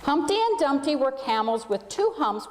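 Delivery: plain reading voice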